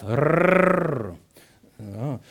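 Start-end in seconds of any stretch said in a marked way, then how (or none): 0.0-1.1 rolling motor noise; lawnmower rumble. Retold or imitated. Joke's lawnmower growling at the sheep, loud rolled motor noise. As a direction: buzz like a lawnmower starting. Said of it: "sagt der Rasenmäher zum Schaf".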